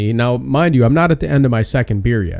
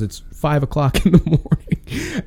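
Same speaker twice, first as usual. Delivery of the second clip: laughing; 4 semitones higher, slower than usual. Retold or imitated. Quoting nurse while laughing.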